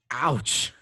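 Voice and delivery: ordinary talk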